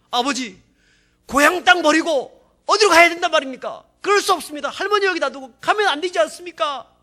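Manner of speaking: high, loud, tearful pleading to father; crying plea. Retold or imitated. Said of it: "막 울면서 그런 얘기를 하는 거예요". (울먹이며 높고 크게 애원)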